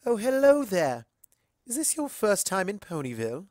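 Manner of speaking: a gracious society mare welcoming a stranger; Rarity, elegant and gracious. A narrator's reading. Rarity greets newcomer.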